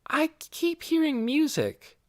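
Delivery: put-on high-pitched child's voice; puzzled girl. A narrator's reading. Girl noticing music in the house, high and puzzled. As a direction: say it high and curious.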